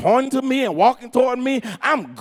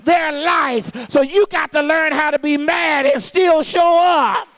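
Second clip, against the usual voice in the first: full-force preaching; 4 semitones higher, slower than usual. Acted. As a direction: drive it home to the congregation.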